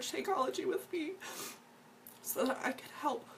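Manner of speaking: high, tearful voice breaking while apologizing; choking up on the words, fighting tears; real crying. Real, not acted. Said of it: "I wasn't expecting to get emotional".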